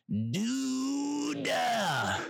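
long, high drawn-out wail; high-pitched frustrated howl; exasperated cry. Acted. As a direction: draw the word out high in exasperation. Exasperated outburst at the unhelpful airline.

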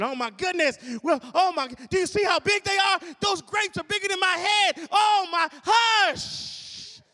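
loud, high exclaiming about the giant grapes; mock murmuring crowd. Folks murmuring about the giant grapes, mock alarm. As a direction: gasp and fret over the size.

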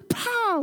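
very high rapid hoots, then "pow"; comic sound effect. Pretend. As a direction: mimic a falling blow landing with a pow. Imitated noises after friend's unexpected reply.

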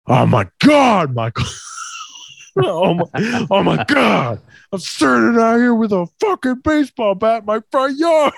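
dad's outburst, climbing high; imitated outraged father; mock exasperated yell. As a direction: exclaim in high, strained disbelief. Dad reacts to prank confession.

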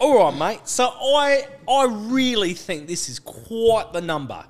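a bad put-on English accent. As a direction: ham up a British bloke. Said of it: "Is that your English accent?".

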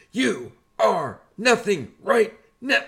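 mock drill sergeant putting a recruit down; drill sergeant bark.

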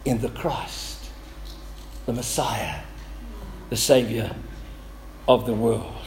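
a hushed, reverent lowering of the voice; hushed reverence. Real, not acted. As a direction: drop the voice low as if in awe. Reverent build to title of Christ.

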